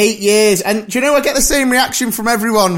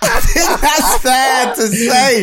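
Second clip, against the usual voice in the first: laughing shout; 6 semitones higher, slower than usual. Real, not acted. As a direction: shout the repeat through a laugh, higher. Teasing co-host, shouts the repeat.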